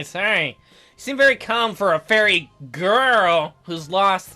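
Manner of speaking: normal talking voice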